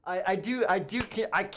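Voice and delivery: crowing that he was right; excited. Real, not acted. Excited gloating at chat.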